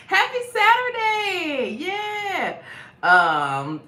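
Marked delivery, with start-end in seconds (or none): 0.1-2.6 high, loud, stretched-out cheer; whooping greeting. Real, not acted. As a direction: holler a happy welcome.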